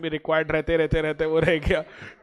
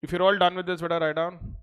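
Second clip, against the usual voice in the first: ordinary talk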